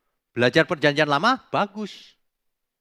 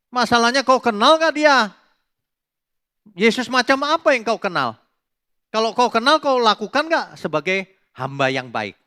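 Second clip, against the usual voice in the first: higher, louder challenging question; preaching at full force, higher and louder; forceful challenge; 6 semitones higher, 6 dB louder than usual. Real, not acted. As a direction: press the question hard on the listeners.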